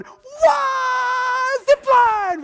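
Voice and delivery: high, shouted disbelief; mock startled shout. Pastor acting his younger self's shock at the zip line, high startled shout. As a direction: yelp it in alarmed surprise. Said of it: "And I'm going".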